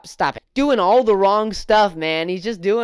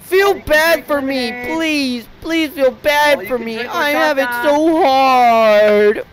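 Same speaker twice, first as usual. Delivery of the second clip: high, slow, fake-crying plea; high slow whine breaking into mock sobs; mock pleading whine; 6 semitones higher, 6 dB louder, slower than usual. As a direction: beg for pity like a fake sob story. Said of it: "where he's like".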